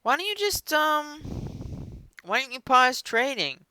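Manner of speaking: high, wheedling businessman pitch; scheming caller voice. Retold or imitated. A shady caller coaxing the Robinhood founder, high and wheedling. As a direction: coax him like a sly insider on the phone. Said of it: "And then he's like".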